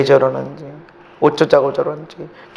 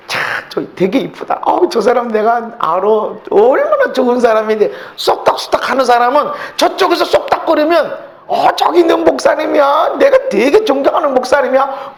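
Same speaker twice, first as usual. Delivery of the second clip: admiring gossip; 10 semitones higher, 4 dB louder than usual. Retold or imitated. Mimics admiring whisperers.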